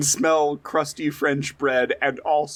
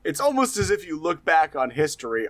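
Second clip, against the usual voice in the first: ordinary talk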